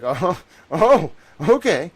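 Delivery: high, loud "oh" with a laugh; mock taken aback. Streamer replays reacting to mom, mock taken aback. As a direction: laugh off the rejection. Reacting to mom's blunt rejection.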